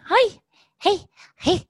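very high, sing-song greeting. Real, not acted. Owner greeting her dog in high baby talk.

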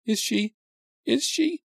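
high, stammering frightened question. A narrator's reading. Best friend stammers asking if she is dead, high and scared. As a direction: stutter out the question in fear. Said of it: "Judy Mason stammered".

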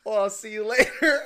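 cracking up while quoting; laughing through the line. Retold or imitated. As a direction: giggle it out mocking her. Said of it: "she's like".

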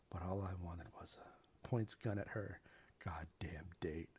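low, soft menacing whisper. Acted. Villain threatening Katie at gunpoint, whispering. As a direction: hiss the threat under your breath. Ezra's menacing climax line.

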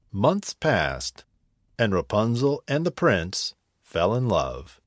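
ordinary delivery, nothing marked

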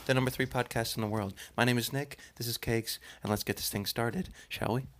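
mild-mannered children's host impression; Mister Rogers. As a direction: gently welcome everyone like a kindly TV host. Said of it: "why did you sound like Mister Rogers".

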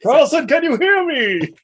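B-movie hero calling to Carlson, high and slow; movie quote impression. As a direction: plead into the void like a B-movie hero. Quoting the film character.

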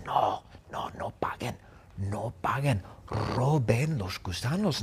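hushed whisper; secret whisper. Acted. Hayato secretly urges friends not to pay, whispering. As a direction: whisper it like a conspirator. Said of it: "Y Hayato les dice".

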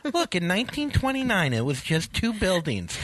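high, dreamy gawking traveler; oblivious tourist voice. Retold or imitated. Mocking dawdling airport walkers.

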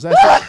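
sudden explosive sneeze; loud sneezing. Real, not acted. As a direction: let out a huge aggressive sneeze. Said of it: "I have to sneeze loudly".

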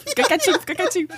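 calm him down fast; hushing dad. Retold or imitated. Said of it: "Senta aí com a minha mãe".

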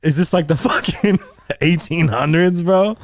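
high, incredulous retelling; retold shock. Robbed clerk retelling his disbelief at the old gun, high and incredulous. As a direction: react in amused disbelief. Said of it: "I was in shock, dude".

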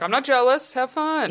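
high, sing-song fake nonchalance; mock breezy. Mocking the cool-girl text to a boyfriend, sing-song. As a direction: sell the pretend cool girl.

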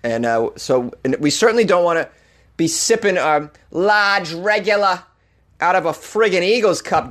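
hammed-up Boston accent, griping and indignant. A Dunkin's customer ranting about an Eagles cup, Boston accent. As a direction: play an aggrieved Bostonian with a thick local accent. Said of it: "whip out that Boston accent".

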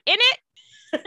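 put-on high-pitched, jokey English accent; mock English accent. Co-host voices Catherine newly turned English, mock English accent. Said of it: "I guess I'll do the accent".

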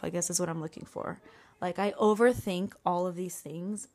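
usual speaking voice

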